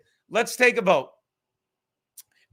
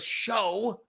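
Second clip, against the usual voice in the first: imitating Charlie; 3 semitones lower, 3 dB quieter, much slower than usual. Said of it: "He goes".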